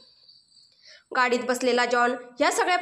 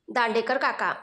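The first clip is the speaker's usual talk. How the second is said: ordinary delivery, nothing marked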